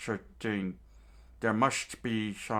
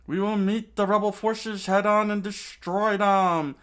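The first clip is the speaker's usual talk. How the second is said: loud, higher battle cry; rallying shout; 13 semitones higher, 10 dB louder than usual. Acted. Chaka rallying the guards with sword raised, loud. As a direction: roar it out to the troops. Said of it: "screamed out to all the guards".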